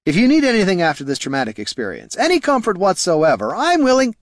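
eager suitor rising to a high finish; over-eager bard. Acted. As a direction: press your comfort on her, pitch climbing. Bard presses comfort on the lady.

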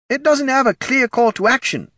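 very high, loud put-on voice; high-pitched eager know-it-all; helpful undead participant. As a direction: offer advice in a squeaky-high voice. Ted's voice in story.

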